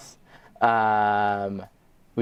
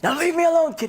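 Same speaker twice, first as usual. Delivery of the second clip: much higher, fed-up voice; exasperated plea; 3 dB louder than usual. Retold or imitated. Defeated thief telling the kid to leave, exasperated. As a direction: beg the kid to go away.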